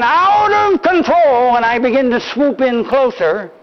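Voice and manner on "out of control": slow, high playful yell; hammed-up war cry; mock yell again. Grandpa ignores the boy's no and yells his cry. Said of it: "I yell again".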